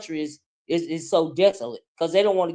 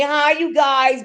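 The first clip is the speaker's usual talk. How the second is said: slow, higher mock valley girl greeting; pink girl accent; 7 semitones higher, 4 dB louder, slower than usual. Mocking a viewer's girly accent, slow and higher. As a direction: draw out a bubbly girly hi. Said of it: "That pink girl accent".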